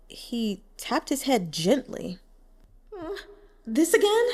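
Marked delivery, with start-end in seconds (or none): 3.7-4.3 surprised. Acted. Fish puzzled by head tap.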